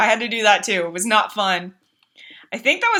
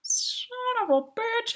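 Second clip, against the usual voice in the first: high soft groan; 13 semitones higher, 10 dB quieter than usual. Retold or imitated. Died for first time.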